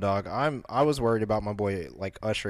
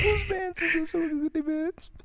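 laughing squeal. Real, not acted. Laughing repeat of the line.

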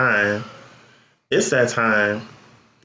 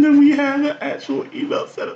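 high, laughing fake sob; mock crying; 12 semitones higher than usual. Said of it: "I don't like crying to y'all".

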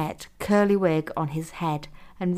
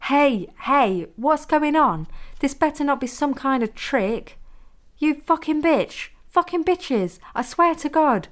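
shouting out; 8 semitones higher than usual. A narrator's reading. Blindfolded captive calls out from the bed, shouting. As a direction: cry out in alarmed outrage. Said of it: "he shouted out".

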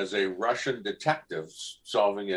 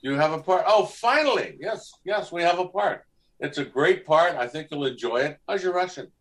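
excited, pitched-up retelling of the call; lightly acted back-and-forth; retold exchange; 8 semitones higher, 4 dB louder than usual. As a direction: bounce between yourself and the producer, voice up high. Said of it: "And I went".

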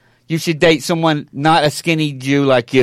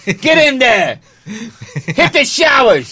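football coach; 4 semitones higher, much slower than usual. Acted. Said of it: "He's like a football coach".

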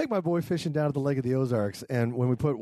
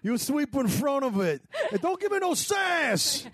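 bossy husband; 7 semitones higher than usual. Pretend. Mock husband bossing wife.

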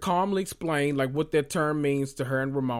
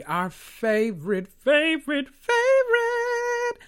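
favorite climbing ever higher and slower; excited build; 13 semitones higher, 4 dB louder, slower than usual. Real, not acted. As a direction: stretch each favorite higher and higher. Excitedly introducing favorite show.

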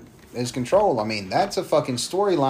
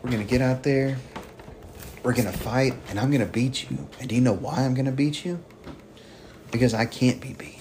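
softer, cool and controlled threat; calm menacing promo; 6 dB quieter, faster than usual. Retold or imitated. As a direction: lay it out quietly, no yelling. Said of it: "the kind of promos I love to cut".

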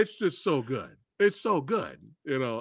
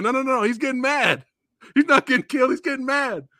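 high, louder insistent correction; excited Apollo quote; 8 semitones higher, 5 dB louder than usual. Retold or imitated. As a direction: insist eagerly he's fine. Quoting Apollo reassuring.

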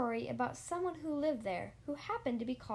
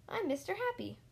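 cheerful greeting; 7 semitones higher than usual. Acted.

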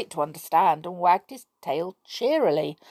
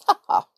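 laughing; 7 dB louder than usual. Real, not acted. Narrator laughs.

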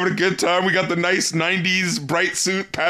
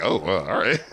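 resigned shrug; 13 semitones lower, 3 dB quieter than usual. Retold or imitated. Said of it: "where you're like".

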